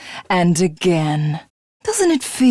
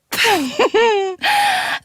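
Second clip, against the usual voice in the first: high gloating villain laugh; high triumphant villain laughter; 12 semitones higher than usual. Acted. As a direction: laugh at the dead. Villain celebrates.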